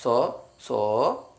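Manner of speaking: സോ, സോ drawn out slowly; teacher stretching സോ, സോ; slow sound demo. Shown as a demonstration. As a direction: sound out സോ, സോ for the class. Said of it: "അപ്പൊ സാ വന്നു".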